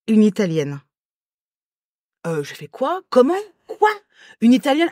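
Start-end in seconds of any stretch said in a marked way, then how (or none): 2.7-3.9 high-pitched, startled questions; retold shock, pitched up; acted bafflement. Acts out her baffled reaction. 4.4-4.9 high, rising incredulous echo; mock shock, pitched well up; acted disbelief. Echoes the request in disbelief.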